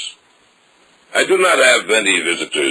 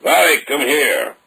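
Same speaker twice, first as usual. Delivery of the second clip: calling out; 10 semitones higher than usual. Acted. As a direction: call him over, firm and carrying. Innkeeper summons man across room.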